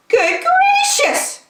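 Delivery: very high, slow gasp of surprise; a shocked bird gasps; gasping Maisie. Acted. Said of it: "gasped Maisie".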